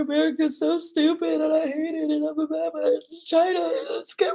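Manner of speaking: high, blubbering mock sobs; crying. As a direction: imitate a drunken tearful rant through sobs. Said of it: "I'm like crying".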